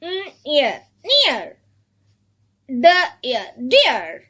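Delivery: "N, ear" apart, then "near" joined; sounded out then blended. Shown as a demonstration. Teacher has children read sounds, split then blended. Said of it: "read these sounds".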